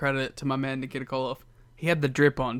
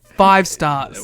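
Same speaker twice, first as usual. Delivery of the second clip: loud verdict; 4 semitones higher, 12 dB louder than usual. Real, not acted. Rating the match.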